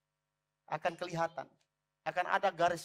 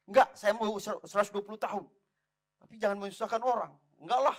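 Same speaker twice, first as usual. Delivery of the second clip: insistent retort; 4 semitones higher, 5 dB louder than usual. Retold or imitated. Retold insistent argument with his pastor.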